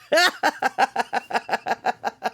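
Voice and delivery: long, rapid rolling giggle; real laughter. Real, not acted. Streamer amused by viewer's bag request, laughing. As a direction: laugh in a long run of quick pulses.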